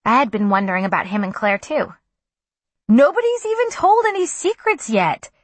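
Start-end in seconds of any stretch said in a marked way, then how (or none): 2.9-5.3 high, loud protest. A narrator's reading. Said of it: "he protested".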